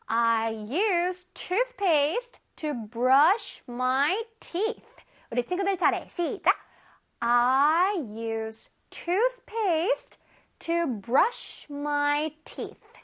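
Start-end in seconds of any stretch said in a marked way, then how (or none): 0.1-4.8 very slow, word-by-word teacher's model; slow modelling. Shown as a demonstration. Teacher reads an example for children, very slowly. 7.2-12.9 very slow, word-by-word teacher's model; slow modelling. Shown as a demonstration. Teacher reads an example for children, very slowly.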